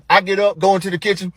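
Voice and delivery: normal talking voice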